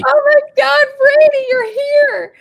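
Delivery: high, loud thrilled greeting; joyful reunion. Real, not acted. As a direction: greet a friend with delight. Greeting Brady arriving.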